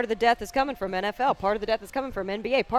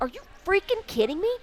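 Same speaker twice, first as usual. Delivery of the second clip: higher-pitched disbelief; retold outraged comeback, pitched up; incredulous retort; 7 semitones higher than usual. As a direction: fire back in exasperated disbelief. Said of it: "I'm like".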